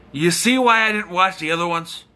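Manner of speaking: ordinary talk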